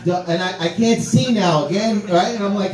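ordinary talk, nothing marked

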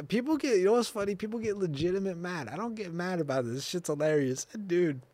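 usual speaking voice

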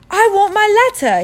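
put-on high, loud boy's shout. Boy refuses to move without his letter, shouting. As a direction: yell it at a high pitch. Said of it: "he shouted".

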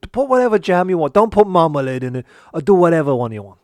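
loud fast irritated rant. Acted. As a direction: rattle it off, annoyed. Chef snaps about the jam.

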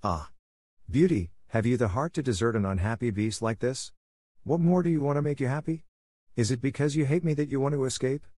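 lightly voiced miserable beast begging her to stay; cried miserably. A narrator's reading. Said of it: "cried miserably".

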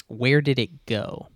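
ordinary talk

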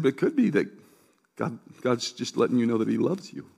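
soft, low, gentle retelling; tender reassurance. Retold or imitated. Pastor telling a crying woman God loves her, tender reassurance. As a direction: quietly reassure someone in tears.

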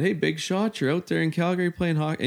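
high-pitched mock tirade, moderate volume; retold yelling. Retelling the leader berating him for playing hockey, mock yelling. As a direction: imitate a taunting yell without full volume. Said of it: "screaming and yelling".

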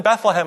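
Bethlehem in three syllables, BETH-le-ham; outsider's pronunciation. Acted. Local mimics an outsider, Bethlehem said as full Beth-le-ham. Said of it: "when someone says".

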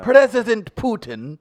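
slow, high, loud Russian accent; bad Russian accent. Shown as a demonstration. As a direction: strain through a hammed-up Russian accent. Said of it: "I could do a Russian".

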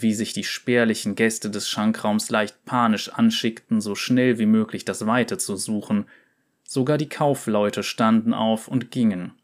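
ordinary delivery, nothing marked

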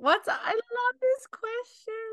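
high, drawn-out squeal of delight; mock gushing. Co-host copying the gush about a listener question.

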